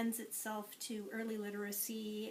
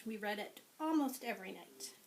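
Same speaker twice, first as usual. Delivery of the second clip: usual speaking voice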